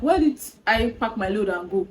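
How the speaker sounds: ordinary talk, nothing marked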